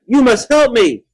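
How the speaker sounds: loud, high plea; desperate yell. A narrator's reading. Monster tugging at neck pleads with child, yelling. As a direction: beg at a yell. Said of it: "the monster yelled".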